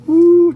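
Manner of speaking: big loud "woo"; delighted whoop. Acted. Storyteller showing the magic in the music, loud delighted whoop.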